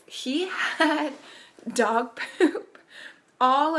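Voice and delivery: words broken by real laughter; laughing. Real, not acted. Mom recounting the poop mishap, laughing through words.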